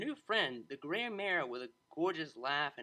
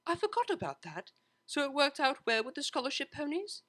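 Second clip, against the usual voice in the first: put-on a higher, eager young mare; Octavia's voice; 11 semitones higher, 4 dB louder than usual. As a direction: lift into the cellist's bright voice.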